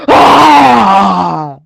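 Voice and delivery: long, loud, high scream; scream in the face. Retold or imitated. Acting out the wrestler screaming in his face, full scream. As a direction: let out a full-throated imitated scream. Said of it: "screams in his face".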